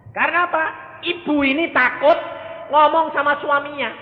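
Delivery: high, loud sermon voice; fiery preaching. Acted. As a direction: preach it out to the hall. Preaching at full force. (khotbah berapi-api)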